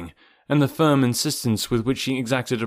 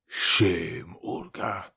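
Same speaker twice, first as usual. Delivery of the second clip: lower, whispered sneer; taunting whisper; 4 semitones lower, 4 dB quieter, much slower than usual. Acted. Rokoff shames his sister, whispered taunt. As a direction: breathe her name with contempt.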